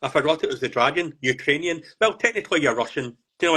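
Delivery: ordinary talk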